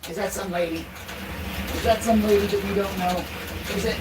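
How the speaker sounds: high, soft sing-song to the dog; doggy talk. Real, not acted. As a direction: coo gently at the running dog. Coos to the dog running.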